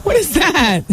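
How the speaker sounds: high, incredulous laughing question; laughing disbelief. Real, not acted. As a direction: crack up while asking, pitch shooting up. Laughing bafflement at the recipe.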